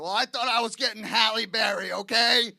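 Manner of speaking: mock-outraged husband shouting back; acted indignant retort. Acted retort to complaining wife.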